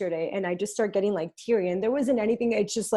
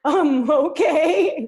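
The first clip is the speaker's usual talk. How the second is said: laughing resignation; 7 semitones higher, 8 dB louder, much slower than usual. Retold or imitated. Giving in to mom.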